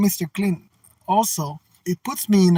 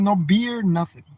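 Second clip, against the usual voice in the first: ordinary talk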